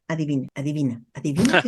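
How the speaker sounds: low, teasing sing-song guessing game; playful child. Retold or imitated. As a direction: tease like a child who won't answer. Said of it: "me respondes desde el niño". (niño juguetón que no responde)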